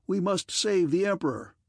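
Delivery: an officer rallying the guardsmen, shouting; urgent shout. A narrator's reading. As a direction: call the men to rescue him. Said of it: "shouted an officer of the guardsmen".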